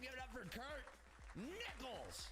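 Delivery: high-pitched whooping hype; excited shout-out, long high whoop to start; celebratory cheer. Real, not acted. Streamer celebrates a viewer's funded milestone.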